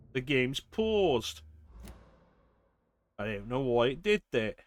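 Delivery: normal talking voice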